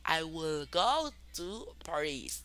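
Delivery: slow, deliberate English example; careful classroom pace; slow model sentence. Shown as a demonstration. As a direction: lay it out slowly for learners. Teacher models will example.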